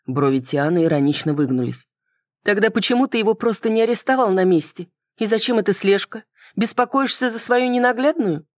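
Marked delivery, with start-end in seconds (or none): 2.4-8.4 high-pitched female character voice, teasing; woman's voice with a touch of irony; ironic, needling woman. Acted. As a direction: challenge him with raised-pitch questions.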